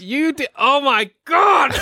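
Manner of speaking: high, drawn-out shout; slow disbelieving yell; exasperated shout. Real, not acted. Outburst at friend's mistake.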